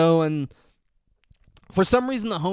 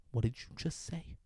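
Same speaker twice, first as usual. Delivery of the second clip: much softer, menacing question; mock tough-guy menace; 2 semitones lower, 12 dB quieter than usual. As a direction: lean in quietly, threatening. Rival's menacing reply, acted.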